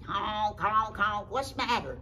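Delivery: honk, then ask like a goose. Acted. Goosey Lucy asks Henny Penny, honking loudly. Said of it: "Goosey Lucy".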